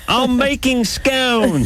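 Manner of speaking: high, drawn-out mock Englishwoman; mock English girlfriend. Host mimics ex-girlfriend defending her baking, mock English girlfriend. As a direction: squeal it slowly as a caricature English girlfriend. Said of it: "I had this English girlfriend".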